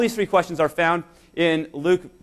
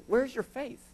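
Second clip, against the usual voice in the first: disappointed rebuke; 6 semitones higher, 10 dB quieter, slower than usual. Retold or imitated. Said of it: "with disappointment, correction or disgust".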